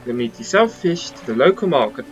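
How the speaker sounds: pleased, polished posh English host; posh English mayor. Acted. Mayor greets the returning fisherman in a light posh English voice. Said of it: "Oh, the, the posh Englishman".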